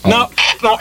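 normal talking voice

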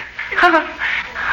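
high, breathy nervous giggle; nervous laugh. Acted.

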